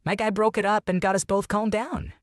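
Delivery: high-pitched character quote; veteran's quoted line. A narrator's reading. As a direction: read it as a quote, pitched well up. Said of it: "Vietnam said".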